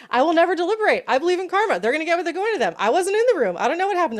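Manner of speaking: high, loud hammed-up defiant juror; mock defiant juror. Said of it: "I was like".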